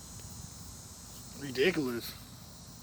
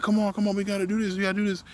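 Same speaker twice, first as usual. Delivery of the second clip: higher-pitched mock hurrying; pestering coworker, pitched up; imitated nagging; 6 semitones higher, faster than usual. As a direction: mimic a pushy coworker hurrying you along. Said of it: "getting on my ass just to get on my ass".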